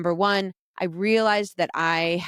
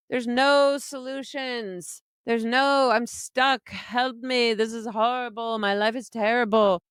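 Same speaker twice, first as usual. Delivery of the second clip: higher, whiny helpless-victim voice, imitating herself; mock victim whine; 5 semitones higher than usual. Mocking her old self to listeners, a high helpless whine. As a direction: play the stuck victim begging for help.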